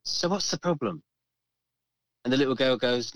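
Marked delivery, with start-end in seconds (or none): 0.1-1.0 lightly imitated nurse, higher pitch. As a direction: ask it higher, like a nurse to a patient. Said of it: "the nurse says".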